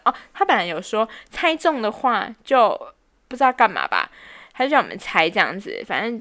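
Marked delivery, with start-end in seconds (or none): none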